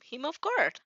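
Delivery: usual speaking voice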